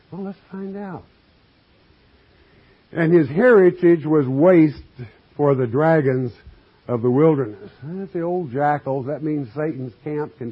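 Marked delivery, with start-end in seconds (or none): 2.9-7.7 louder, measured pulpit reading; scripture reading. Acted. Preacher reading scripture to his congregation, declaiming. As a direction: read the verse out to the flock.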